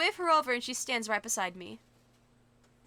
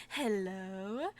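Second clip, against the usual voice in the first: copying her sly hello; imitating friend's hello; 3 semitones lower, 3 dB quieter than usual. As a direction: echo her drawn-out hello. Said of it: "echoes Valerie's hello".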